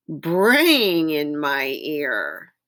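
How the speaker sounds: long, drawn-out mock bray on the word. Reader acting out the zebra for children, braying. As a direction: stretch braying out like a zebra's call.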